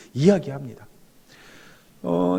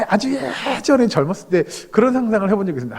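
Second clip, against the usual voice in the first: ordinary delivery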